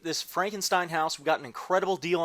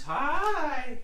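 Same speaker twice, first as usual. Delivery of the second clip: high squeaky pet greeting; 11 semitones higher than usual. Real, not acted. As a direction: coo hello to the kitties. Greeting the kitties.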